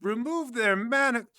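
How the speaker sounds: high, loud, slow repeat in disbelief; aghast, stretched-out echo that breaks off; incredulous echo. Acted. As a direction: balk at an outrageous request. Warden balks at the request.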